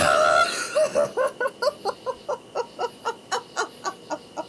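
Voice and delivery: long high laugh in spaced-out bursts; helpless laughing fit. Real, not acted. Streamer cracking up at a terrible joke, helpless laughter. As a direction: laugh on and on until out of air.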